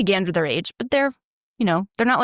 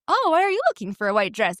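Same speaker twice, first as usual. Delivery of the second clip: higher, light innocent asking, rising on "Oh"; curious friendly question; 6 semitones higher than usual. Shown as a demonstration. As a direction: ask like a harmless curious shopper. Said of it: "It wasn't".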